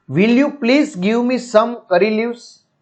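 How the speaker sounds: very slow, each word laid out; slow classroom read of a polite request; slow dictation reading. Shown as a demonstration. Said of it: "teacher reads English line".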